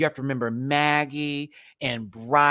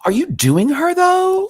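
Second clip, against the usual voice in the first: high, loud questioning, rising at the end; mock incredulous; 13 dB louder, slower than usual. Doubting performers who claim to do the Nanny, mock incredulous. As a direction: challenge it in exaggerated disbelief.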